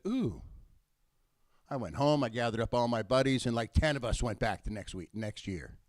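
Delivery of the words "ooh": mock ooh. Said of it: "touched my toe into the water and said".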